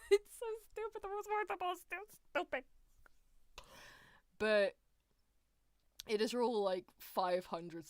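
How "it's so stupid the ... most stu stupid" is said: high, breaking into giggles; can barely get it out for laughing. Real, not acted. Streamer mocking the rule, laughing high.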